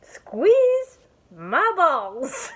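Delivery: very high, slow, loud and stretched; loud drawn-out cry. Real, not acted. Reading a toy's label aloud, a loud drawn-out cry. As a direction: wails the words out long and loud. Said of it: "that was really loud".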